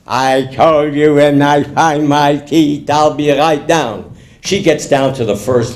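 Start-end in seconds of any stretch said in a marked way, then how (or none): 0.1-4.0 slow, gummy toothless reply; drawn-out toothless mumble; toothless husband. Acted. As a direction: insist unhurriedly as if your teeth are out. Toothless man answers again.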